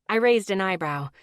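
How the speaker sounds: ordinary delivery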